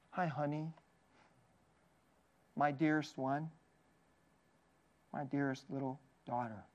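put-on soft, low endearments to a little girl; tender. Pastor voicing Jesus to the dead girl, tender and soft. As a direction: hush it like waking your sweetheart. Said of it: "It's extremely tender".

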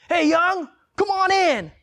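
high, loud salesman greeting; over-friendly, high-pitched holler; used car salesman parody. Retold or imitated. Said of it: "parody of, like, a used car salesman".

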